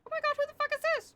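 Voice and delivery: fast, high exasperated outburst. Acted. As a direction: blurt it out in disbelief. Exasperated caller on the radio.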